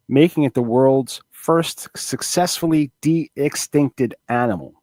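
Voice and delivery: quiet article read; reading aloud. Acted. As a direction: read it like a news piece. Reads a news article aloud.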